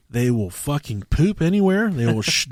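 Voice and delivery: ordinary delivery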